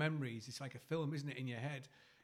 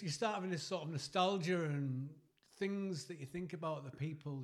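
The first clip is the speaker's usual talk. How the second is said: usual speaking voice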